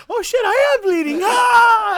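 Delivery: high, loud yell of shock at the blood; a startled cry on seeing blood. Retold or imitated. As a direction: shout it out, shocked and half laughing. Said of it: "he's like".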